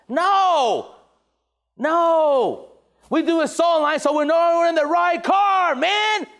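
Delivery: high, forceful correction building to a shout; emphatic rebuttal. Real, not acted. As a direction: drive it home with rising force. Heated rebuttal to team.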